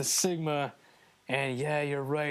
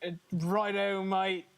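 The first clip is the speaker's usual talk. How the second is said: higher, hammed-up fake Australian; stretched-out, clumsy Australian accent; bad Australian accent; 3 semitones higher, slower than usual. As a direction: do a terrible Australian imitation. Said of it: "that's my worst accent I've done".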